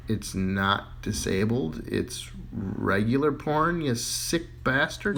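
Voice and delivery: very slow, flat clerk; slow deadpan. Acted. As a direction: drag it out deadpan.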